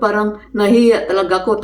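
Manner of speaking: ordinary talk, nothing marked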